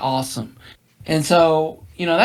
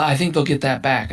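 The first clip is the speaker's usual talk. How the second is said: ordinary talk, nothing marked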